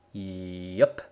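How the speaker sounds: a lightly voiced, terse farm stallion; laconic yup. A narrator's reading. Big Macintosh answering his sister with a lightly voiced, terse yup. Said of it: "in his characteristic manner".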